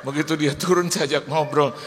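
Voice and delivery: ordinary talk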